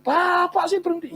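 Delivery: high-pitched, acted-out rebuke; scolding zookeeper. Zookeeper tells off the driver who stopped, scolding.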